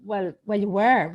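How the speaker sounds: you were with a loud, falling were; telling the therapist she was moving her head; insistent retort. Retold or imitated. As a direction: push back firmly on the word were. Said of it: "And I thought".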